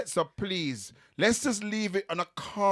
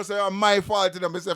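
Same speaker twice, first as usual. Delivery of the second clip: ordinary talk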